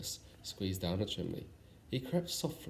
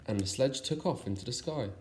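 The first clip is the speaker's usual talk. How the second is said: ordinary delivery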